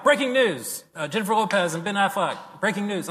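mock a breathless TV anchor; hammed-up anchor alert; news anchor. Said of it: "it's like".

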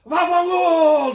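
high, loud, slow Italian yell, va fangul stretched out; Italian buddy yelling. Retold or imitated. Italian buddies yelling at each other in school, loud Italian accent. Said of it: "some of them were loud".

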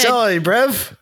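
put-on British street voice; mock British accent. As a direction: apologise like a British lad. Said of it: "to British credit cards".

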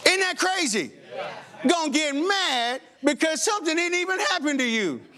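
high, laughing disbelief. Real, not acted. Preacher laughs at anger over others' offense, amused disbelief. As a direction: laugh it off as ridiculous.

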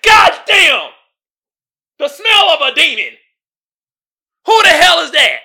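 high, loud disgusted shout; shocked Monique. Retold or imitated. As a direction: recoil from the stench with a yell. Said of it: "she was like".

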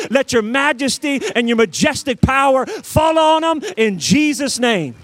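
prayer building to full-force, loud preaching; fervent prayer. Real, not acted. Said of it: "here's what I'm praying".

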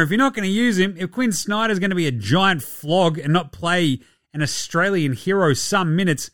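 normal talking voice

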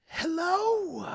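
very high, squeaky doctor greeting; high squeaky phone hello; doctor's voice. Acted. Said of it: "He goes".

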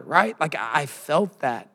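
usual speaking voice

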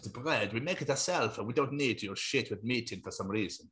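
soft, hesitant mock French accent; quiet, low French accent; French shopkeeper. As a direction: explain the wares as a put-on Frenchman. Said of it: "They're like".

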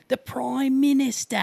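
exaggerated Aussie "Prime Minister", high and slow; mock the American take on Australian; hammed-up cartoon Australian "Prime Minister"; Simpsons Australian accent. Shown as a demonstration. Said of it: "Americans make it sound like The Simpsons Australian".

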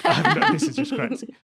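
high-pitched laughing protest; mock bewilderment. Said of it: "everyone would just be like".

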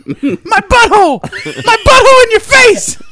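very high, loud triumphant shriek; shouting kid. Retold or imitated. Hyper kid screams the insult at Voha, high and loud. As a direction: yell it like a gleeful little brat. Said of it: "kid yells".

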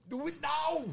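high, strained mock command; hammed-up action-hero order; Arnold character voice. Druid demanding instant action, Arnold impression. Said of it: "in that accent for that voice".

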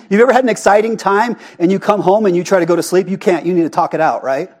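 ordinary talk, nothing marked

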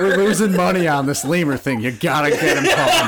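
high, loud mock-boss shout. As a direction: yell like a panicking boss.

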